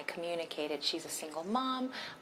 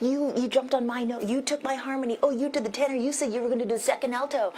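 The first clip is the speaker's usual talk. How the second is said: higher, louder mock accusation through laughter; playful teasing quarrel; 6 semitones higher, 6 dB louder, faster than usual. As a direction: tease mom like a kid bickering over harmony. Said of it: "laughing and giggling".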